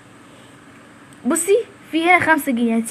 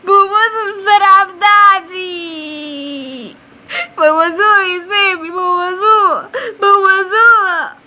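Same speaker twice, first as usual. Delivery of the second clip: slow, drawn-out mock wailing; hammed-up grief, dragging every word; melodramatic sobbing; 3 semitones higher, much slower than usual. Crying to his friend over a ruined plant, overdone sobbing. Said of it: "عامل لي كل الفيلم الهندي ده".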